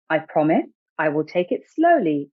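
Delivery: ordinary delivery, nothing marked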